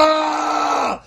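long, high-pitched despairing "aah"; yelled-out cry. Retold or imitated. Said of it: "I yelled out".

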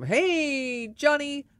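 high, drawn-out mock hail; called-out greeting. Bar regular greeting a popular man, calling out. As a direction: imitate a regular hailing a buddy.

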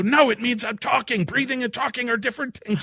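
high, exasperated mock protest; hammed-up frantic retort; indignant arguing. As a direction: argue back in comic panic. Acted quarrel with wife.

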